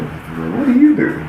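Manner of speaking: high, slow mock questioning voice; family asking. Relatives questioning the boy, high slow mock voice. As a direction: stretch it high like a puzzled relative.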